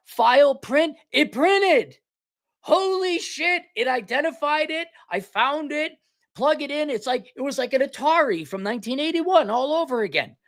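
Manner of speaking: high, giddy disbelief; thrilled rant that the printer just works; ecstatic amazement. Real, not acted.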